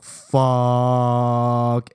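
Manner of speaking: long drawn-out curse; dawning dread. Retold or imitated. Boy sees the principal walk in, drawn-out dread. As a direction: stretch the word out as doom sinks in.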